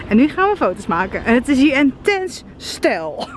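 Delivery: ordinary talk, nothing marked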